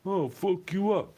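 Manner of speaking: high, slow mocking threat; mocking tough guy. Mocking the driver who cut him off, slow and high. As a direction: drag it out like a dumb tough guy. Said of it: "He came up to the window".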